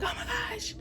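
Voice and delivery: high, fast, thin panic; breathless high-pitched panic; mock panicked self. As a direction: squeal it in a rush like your water just broke. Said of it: "I'm like".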